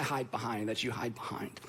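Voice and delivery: unvoiced hushed confession; hushed, leaning in to the listeners; whisper. Real, not acted. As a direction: drop to a whisper, as if admitting a secret. Confiding aside to congregation.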